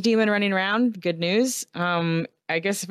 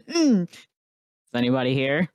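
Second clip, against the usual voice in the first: ghost voice; 4 semitones lower than usual. Acted. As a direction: call out like a wandering spirit. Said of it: "definitely using ghost voice".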